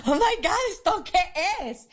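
shocked and delighted at once; shocked exclaim. Retold or imitated. Reading the first steamy scene in the waiting room, shocked.

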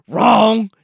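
ordinary delivery

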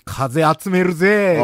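風集めるぜ said slow and swaggering; slow cocky delivery of 風集めるぜ; playful tough-guy 風集めるぜ; mock tough line. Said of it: "イントネーションがおかしい".

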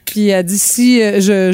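ordinary talk, nothing marked

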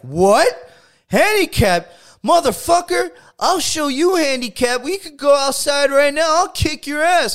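high, loud furious threats; belligerent drunk. Retold or imitated. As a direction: shout it like a drunk picking a fight. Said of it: "he's like".

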